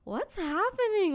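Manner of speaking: high, drawn-out mock bewilderment; slow, high incredulous question; baffled onlookers. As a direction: imitate passengers wondering at the scene. Amused onlookers react in retelling.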